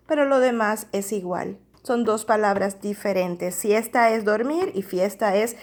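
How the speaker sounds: ordinary delivery, nothing marked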